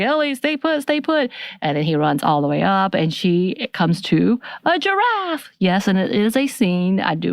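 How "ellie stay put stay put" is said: high-pitched mock panic; retold freaking out. Joel tells Ellie to stay put, mock panic. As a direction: imitate someone freaking out, voice jumping high. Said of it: "he's freaking out".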